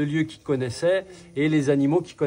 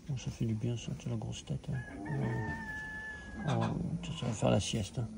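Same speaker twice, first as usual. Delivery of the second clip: much softer murmur to a dog; talking to a dog; 2 semitones lower, 11 dB quieter than usual. Real, not acted. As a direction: murmur tenderly to the puppy.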